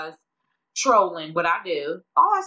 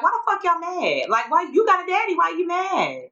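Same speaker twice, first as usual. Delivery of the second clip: higher-pitched mock-exasperated questioning; baffled challenge; 8 semitones higher than usual. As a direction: throw it back at them, pitched up. Retells challenging angry commenters.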